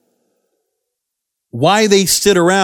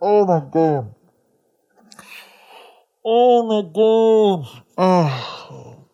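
exasperated disbelief; much slower than usual. Real, not acted. Disbelief after reading promo.

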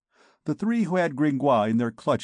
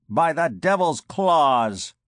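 slow, louder curse; angry interruption; 3 semitones higher, 5 dB louder, slower than usual. A narrator's reading. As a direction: cut him off with an oath. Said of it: "interrupted Clopin".

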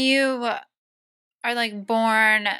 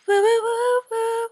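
high whoops; 12 semitones higher than usual. Real, not acted. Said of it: "cheering the show name".

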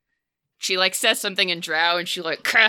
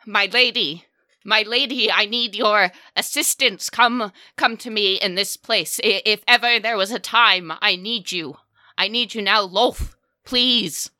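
loud, raised, pleading invocation; calling out; 3 semitones higher, 3 dB louder than usual. Acted. Matron mother summoning Lolth for help, calling out desperately. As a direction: beg urgently, calling up to a goddess. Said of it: "she begins to call".

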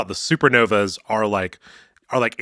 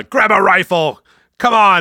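higher, louder urging shout; rally them with a mock command; acted battle cry; 7 semitones higher, 5 dB louder than usual. Acting out Q's battle order.

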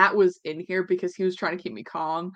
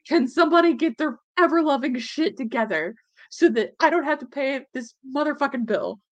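high-pitched, fed-up pleading; exasperated plea; 7 semitones higher, 3 dB louder than usual. Retold or imitated. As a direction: retell your desperate rant at the insurer, voice raised. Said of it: "And I'm just like".

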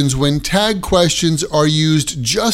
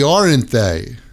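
"aren't they?" dropping low; falling tag; 6 semitones lower than usual. Shown as a demonstration. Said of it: "the tag question will have falling intonation".

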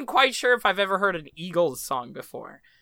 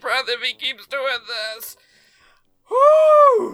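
put-on old man voice; 5 semitones higher, 3 dB louder than usual. Shown as a demonstration. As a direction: croak it out like an elderly grandpa. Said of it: "this is my old man voice".

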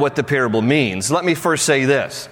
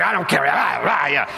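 mock angry farmer. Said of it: "Is this angry farmer?".

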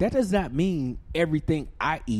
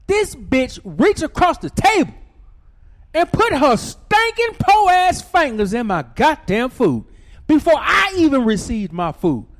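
high, loud and indignant; furious that she touched the food first; outraged loud telling; 9 semitones higher, 9 dB louder than usual. Retold or imitated. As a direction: rage about the fingers in the food.

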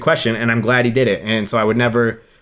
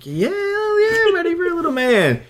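pitched way up, mock-eager reply; hamming up an eager yes. Telling a coworker he's ready for a son, high mock-eager yes. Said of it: "I'm like".

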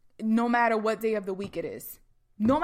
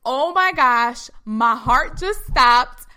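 big, loud overjoyed shock, words stretched out; stunned excitement; 10 dB louder than usual. Retold or imitated. Woman hearing thrilling news on the phone, loudly stunned. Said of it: "I literally said it like that".

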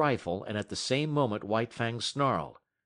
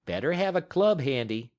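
a slightly louder cautious warning; 5 semitones higher, 5 dB louder than usual. Acted. Matt warning Scott to keep a club, mild warning. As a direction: caution him, a little raised. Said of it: "Matt warned".

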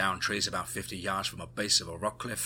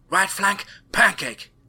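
urgent yell, much higher and louder; shouted warning; 11 semitones higher, 10 dB louder, slower than usual. A narrator's reading. Tammy ordering the squad down, urgent yell. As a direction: call it out so they hit the dirt. Tammy calling targets in battle.

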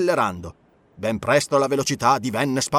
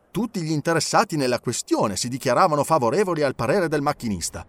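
ordinary delivery, nothing marked

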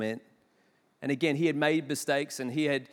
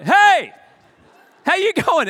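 loud and high, blasting a hello; loud cheery hello to a sleeping passenger; too loud greeting; 12 semitones higher, 12 dB louder, slower than usual. Retold or imitated. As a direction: boom a greeting at a sleeping man. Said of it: "I have sometimes a volume perception problem".

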